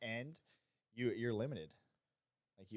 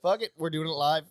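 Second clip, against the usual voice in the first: mock rant; 5 semitones higher, 12 dB louder than usual. Quoting famous rant line.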